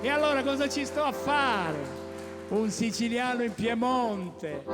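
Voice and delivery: booming show title call; loud announcing. Acted. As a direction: announce it loudly to the crowd.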